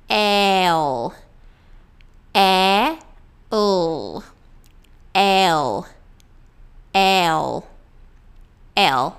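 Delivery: "L" as eh gliding into a held dark l; English dark L. Shown as a demonstration. Teacher drilling English L slowly, dark l ending. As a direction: split "L" into "E" and "O", then join them. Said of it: "Essa é a posição do L".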